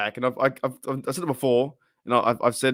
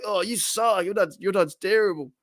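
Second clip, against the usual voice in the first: put-on higher-pitched heckling kid; mock playground taunt; 7 semitones higher than usual. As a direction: imitate a schoolmate jeering at handball. Imitates kid taunting at handball.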